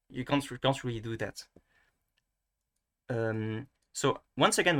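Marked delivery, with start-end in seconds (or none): none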